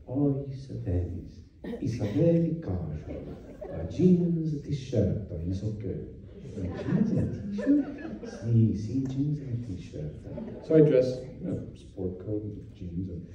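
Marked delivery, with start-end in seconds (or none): 0.1-6.0 soft, mock Italian host voice; Italian accent. Italian host answering dress question. 6.6-7.9 higher, laughing disbelief on t-shirt; incredulous question. Retold or imitated. Guest disbelieving casual dress advice. 8.4-10.1 slow, soft mock Italian reassurance; Italian accent. Italian host confirming casual dress.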